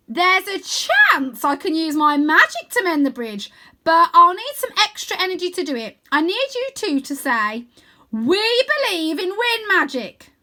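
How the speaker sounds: put-on high, slow and loud goofy boy; calling down in a big high cartoon voice; bright playful wizard-boy voice; Wyn's voice. A narrator's reading. Wyn explains his plan.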